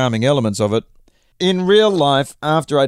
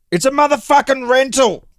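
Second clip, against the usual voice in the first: yelling; 12 semitones higher, 3 dB louder than usual. A narrator's reading. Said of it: "Provence yelled back".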